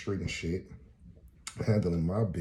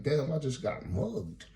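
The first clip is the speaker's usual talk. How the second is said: stunned; 7 semitones higher, slower than usual. Real, not acted.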